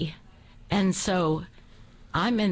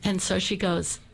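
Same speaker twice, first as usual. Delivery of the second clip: normal talking voice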